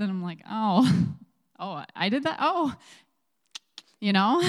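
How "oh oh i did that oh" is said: high, rising "oh, I did that?" with a laugh; mock surprise. Telling the audience about discovering her own branding, playfully surprised. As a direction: act stunned to find out you did it.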